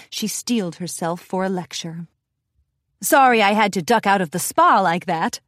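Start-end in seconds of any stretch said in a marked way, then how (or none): none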